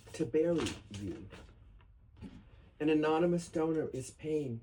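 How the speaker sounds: grave, measured poem recital; solemn reading. Acted. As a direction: read it like an elegy at a memorial. Reads elegy poem aloud.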